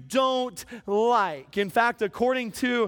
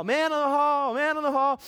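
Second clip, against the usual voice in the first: higher, sustained hallway call; mock yell on a raised pitch; repeated yelled announcement; 4 semitones higher than usual. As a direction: keep calling it out down the hall. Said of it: "all the way down the hall".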